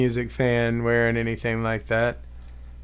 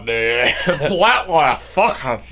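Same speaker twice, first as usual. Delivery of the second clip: hammed-up drunken Scottish accent; mock Scottish accent; 3 semitones lower, 4 dB louder than usual. Showing off the accent just named, drunken mock Scottish. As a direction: slur it like a boozy Scot. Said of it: "I sound like a drunk dude".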